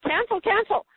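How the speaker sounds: high, insistent inner command; firm cancel. Retold or imitated. Carolyn rejecting the doctor's prognosis, high and insistent. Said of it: "And Carolyn said".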